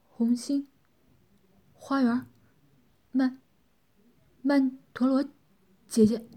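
一个词一个词断续地挤出来; 每个词之间长长停顿，吃力地说; 艰难吐字. A narrator's reading. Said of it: "艰难地吐出几个词". (艰难地断断续续吐出)

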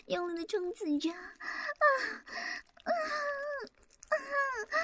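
high, breathless pleading cries; passionate moaning. Acted. Climactic moaning roleplay. (激情呻吟)

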